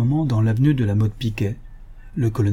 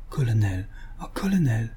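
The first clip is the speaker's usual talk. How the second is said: softer, swooning murmur; deeply moved murmur; 5 dB quieter than usual. A narrator's reading. Flustered lady to the colonel, deeply moved murmur. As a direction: breathe it out, overcome with emotion. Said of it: "Elle murmura, très émue".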